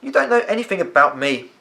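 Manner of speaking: put-on boy turning angry at the gardener; getting angry. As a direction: push back, temper building. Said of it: "beginning to get angry".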